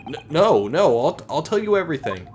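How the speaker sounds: loud, stammering, terrified begging; panicked pleading. Acted. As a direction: blurt it out, scared for your life. Suspect begs after threat of ocean.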